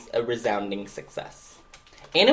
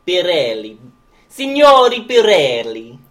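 high, loud, drawn-out mock Italian flourish; terrible Italian accent; 4 semitones higher, 11 dB louder than usual. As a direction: belt it out in a hammed-up Italian accent. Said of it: "Terrible Italian accent".

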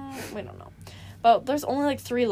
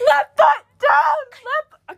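much higher, louder, slow and laughing; shouting directions; 8 dB louder, much slower than usual. Retold or imitated. Guiding a blindfolded kid over mousetraps: laughing shout. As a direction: yell the steps across the maze. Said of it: "I was just shouting".

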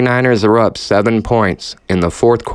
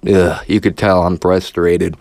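ordinary delivery

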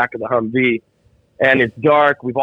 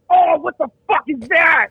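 high, slow, disgusted shout; disgusted yell; 13 semitones higher than usual. Retold or imitated. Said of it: "he goes".

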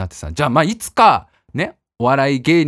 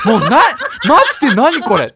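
higher and louder, laughing through it; laughing disbelief; 8 semitones higher, 6 dB louder than usual. Real, not acted. Laughing at the memory with the co-host, disbelieving. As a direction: crack up at how ridiculous it got. Laughing at his own absurd story.

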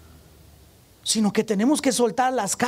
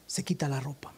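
susurro seco; 9 semitones lower, 10 dB quieter than usual. Retold or imitated. Maestra enojada ordena al niño.

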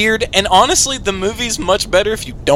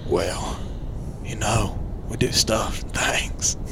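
low, quiet, halting drawl impression; imitated gruff hero, nearly whispered; Rick Grimes; 7 semitones lower, 12 dB quieter, much slower than usual. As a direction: mumble it low with pauses. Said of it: "he'll break into Rip Grimes".

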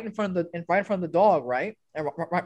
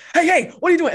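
loud, high, urgent call; retold shouted challenge; 11 semitones higher, 10 dB louder, much faster than usual. Officer catching kid at his backpack, imitated urgent shout. Officer calling out at suspected student.

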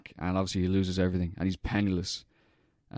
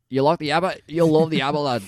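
broad Aussie pub voice, higher and louder; Aussie accent; 7 semitones higher, 5 dB louder than usual. Retold or imitated. Local welcoming the stranger to the Yabba in a thick Aussie accent. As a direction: hail him like a boozy outback local. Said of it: "the accents are just great".

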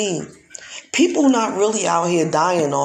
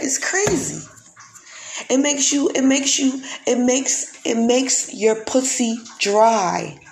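exasperated outburst; 7 semitones higher than usual. Real, not acted. Outraged about HIV ads.

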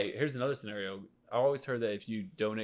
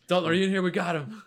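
shouting; 7 semitones higher, 5 dB louder than usual. Acted. FBI raid acted out.

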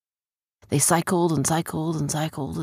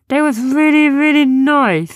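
high, loud, stretched enthusiasm; earnest little creature Sniz; 10 semitones higher, 10 dB louder than usual. Acted. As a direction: gush in a small creature's put-on voice.

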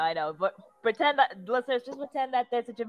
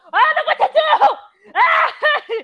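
very high, loud, drawn-out wail; panicked wail; 10 dB louder, much slower than usual. Pretend. Girl torn between two sides, wailing in panic. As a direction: shriek it in overwhelmed despair.

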